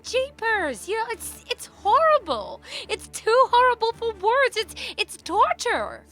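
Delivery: hammed-up horror over the shows; mock horror. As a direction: squeal in theatrical disgust.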